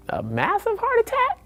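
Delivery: very high, incredulous echo; mock disbelief. Repeating the doctor's diagnosis back, very high mock disbelief. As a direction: echo the doctor in a shocked squeak.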